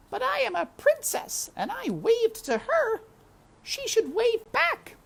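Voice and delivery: high, spoiled little princess; princess complaining. Acted. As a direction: whine it like a pampered royal child. Princess insists she waved.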